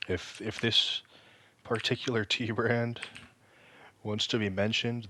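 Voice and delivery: usual speaking voice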